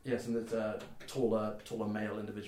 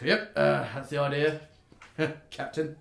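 loud, slightly raised voice with a chuckle; teasing reply; 4 semitones higher, 7 dB louder than usual. Acted. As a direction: tease the captain cheerfully. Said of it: "Uh, goes".

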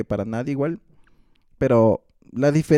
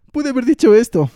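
loud, high cry of regret; frustrated regret; 12 semitones higher, 10 dB louder than usual. Retold or imitated. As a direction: blurt it out, kicking yourself. Regret after a fight, acted.

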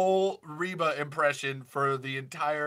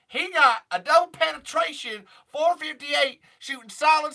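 much higher and louder, twangy Southern brassiness; 8 semitones higher, 6 dB louder than usual. Retold or imitated. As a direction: play a feisty Southern country star.

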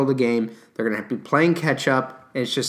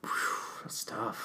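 whispered aside; 4 semitones lower, 16 dB quieter than usual. Real, not acted. Put on the spot, pondering.

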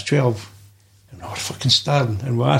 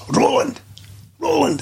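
much higher calling yell, twice; mock manager shout; 12 semitones higher than usual. Manager shouting for a player who won't listen. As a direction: call the name across the training ground. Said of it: "he would shout".

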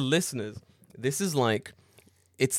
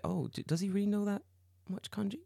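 higher, softer, suspicious; doubtful mock question; 9 semitones higher, 6 dB quieter than usual. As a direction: wonder aloud if it's true. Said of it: "You're like".

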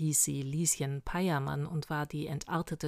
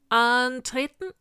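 loud, drawn-out, raised military order; bellowed command; 6 semitones higher, 8 dB louder, slower than usual. A narrator's reading. Hexenweibel calls the squadron to fall in, bellowing. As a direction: bellow it like a sergeant on parade. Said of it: "brüllte er".

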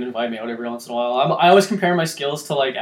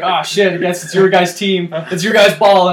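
loud, laughing fake sorry; trolling with fake regret; mock apology laughing; 5 semitones higher, 10 dB louder than usual. Mocking apology while trolling.

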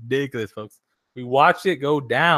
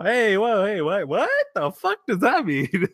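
higher, indignant mock outburst; imitating Rosie Perez; 6 semitones higher than usual. Streamer retelling Rosie Perez's comeback, mock indignant. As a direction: fire back like an offended Rosie Perez. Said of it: "Rosie Prezal".

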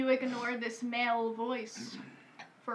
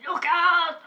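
loud, higher action-hero warning with a bad accent; Arnold Schwarzenegger; 4 semitones higher, 6 dB louder than usual. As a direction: call out in an attempted Austrian accent. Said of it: "I just can't do his accent".

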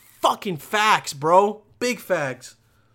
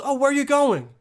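clueless questioner; 7 semitones higher, 3 dB louder than usual. Retold or imitated. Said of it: "and someone goes".

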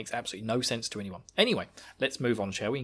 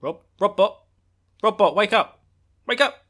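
high, loud wake-up call; shouting; 9 semitones higher, 8 dB louder than usual. Pretend. Yelling at robot to wake.

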